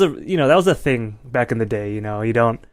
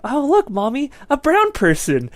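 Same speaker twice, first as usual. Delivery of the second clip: put-on much higher, piping toddler voice; a little kid tugging at mom in a store; small child voice; 12 semitones higher than usual. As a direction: chirp it like a curious toddler. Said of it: "and I was like".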